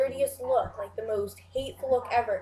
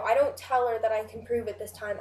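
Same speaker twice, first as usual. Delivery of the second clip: ordinary talk, nothing marked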